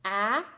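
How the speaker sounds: short A sound, as in "apple"; isolated short "a" for a phonics lesson; short vowel sound. Shown as a demonstration. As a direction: say "a" as the short vowel, not the letter name. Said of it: "that's called a short vowel".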